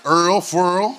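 "Earl, Furl" for oil and foil, one syllable each; dialect pronunciation. Shown as a demonstration. Preacher quizzing congregation on local words, dialect pronunciation. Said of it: "Mom and them kept on saying furl".

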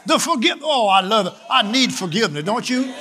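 rising, shouted sermon voice; fervent preaching. Acted. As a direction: preach it with the whole church behind you. Preacher at full force.

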